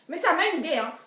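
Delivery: plain reading voice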